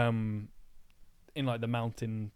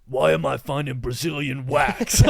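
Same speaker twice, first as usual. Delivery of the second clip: loud, slow mock outrage; hammed-up exasperated parent, much louder; parent impression; 10 dB louder, slower than usual. Mock parent confronts son over wax, loud and outraged. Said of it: "done the impressions of my parents".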